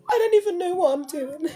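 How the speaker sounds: real tears while speaking; crying. Real, not acted. Emotional, crying on camera.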